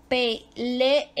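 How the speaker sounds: "Pelé" split as pe, le, e; teacher's syllable-by-syllable pe-le-e for "Pelé"; separated syllables. Shown as a demonstration. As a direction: sound out "Pelé" in three spaced beats, final e repeated. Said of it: "va separada E E".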